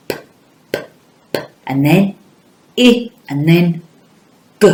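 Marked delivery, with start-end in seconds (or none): 0.1-1.4 P said as a short puffed p sound, not the letter name; phoneme sounds. Shown as a demonstration. Teacher sounding out pig for pupils, bare phoneme. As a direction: clip each p as a bare sound. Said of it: "what's the next phoneme".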